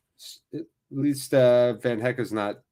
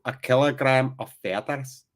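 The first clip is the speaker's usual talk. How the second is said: amateur attempt at a Scottish accent. Commentator practising the riddle for listeners, put-on Scottish accent. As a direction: put on a questioning Scottish lilt. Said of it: "working hard on my Scottish accent".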